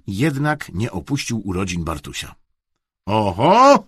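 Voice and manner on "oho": loud rising exclamation; called out. A narrator's reading. Dad hearing the doorbell, calling out. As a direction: call it out to the room. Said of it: "zawołał tata".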